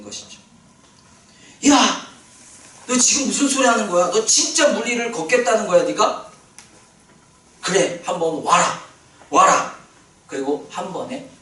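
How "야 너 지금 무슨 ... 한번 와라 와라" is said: higher, louder, incredulous then inviting; Jesus amazed and delighted. Retold or imitated. Preacher voicing Jesus to Peter, loud and delighted. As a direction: play Jesus, astonished and thrilled, calling him over.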